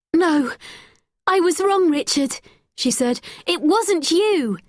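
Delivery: put-on girl urgently realising; urgent realisation. A narrator's reading. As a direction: correct herself urgently. Becky realises her mistake.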